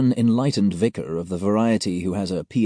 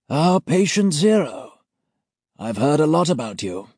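put-on high, louder old gent voice; senior auditor voice; 8 semitones higher, 4 dB louder than usual. A narrator's reading. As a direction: pleasant but menacing elder. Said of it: "doing his best guilty schoolboy impression".

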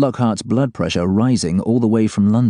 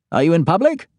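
stressed boss voice; 9 semitones higher than usual. Acted. Boss asks on phone.

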